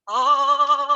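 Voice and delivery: loud high ah while shaking; saying ahh. Shown as a demonstration. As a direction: let out a big ahh with a shimmy. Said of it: "as you make the sound".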